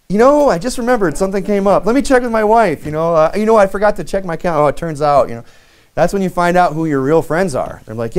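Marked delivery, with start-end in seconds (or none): 0.1-5.3 high, flustered hammed-up excuse; high mock stammering excuse; mock backpedaling excuse. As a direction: wriggle out of the job. Friend makes mock excuse.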